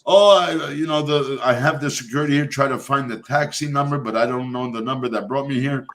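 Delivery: ordinary delivery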